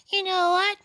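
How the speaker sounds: very high-pitched cartoon character voice; Anthony. Co-host demonstrates phone bit for comedian, high put-on character voice. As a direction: jump way up into a silly high voice. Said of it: "you can do Anthony from F is for Family".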